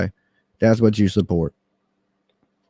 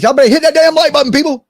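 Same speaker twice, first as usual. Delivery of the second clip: high, loud and fast demand; shouted call-out; 8 dB louder, faster than usual. Real, not acted. To viewers, yelling at them to hit the like button, loud and fast. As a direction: holler at the chat to hit like.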